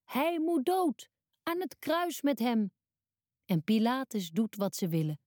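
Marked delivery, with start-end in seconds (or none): 0.0-2.7 much higher and slow, a read-aloud crowd's mild roar. A narrator's reading. Crowd roars again at Pilate to crucify Jesus, slow mild mock roar. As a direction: stretch out the demand like a mob, kept gentle. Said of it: "de mensen brullen weer".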